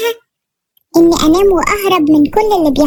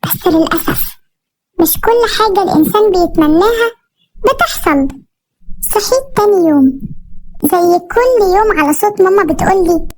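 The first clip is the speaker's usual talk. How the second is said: ordinary delivery, nothing marked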